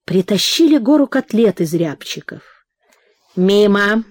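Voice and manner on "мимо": mildly shouted refusal; old man's cry. A narrator's reading. Old man refusing among guests, mild shout. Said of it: "закричал белобородый румяный старик".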